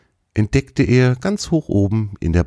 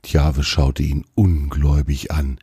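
plain reading voice

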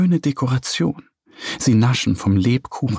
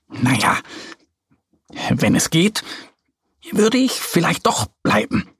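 hesitant hemming; 4 semitones higher than usual. A narrator's reading. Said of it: "druckst die Katze herum".

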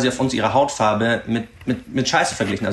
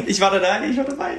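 laughing; 9 semitones higher than usual. Real, not acted. Laughing while confirming she was there.

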